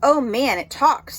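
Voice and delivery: high, loud mocking shout; mocking scream. Bully Eddie taunting the boy, loud high jeer. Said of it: "screamed Eddie".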